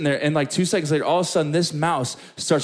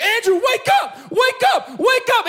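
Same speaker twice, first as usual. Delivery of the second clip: very high, frantic shrieked alarm; full-voiced mock shriek; imitated screaming wife; 4 dB louder than usual. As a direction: scream it like a panicked wife waking her husband. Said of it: "my wife starts screaming bloody murder".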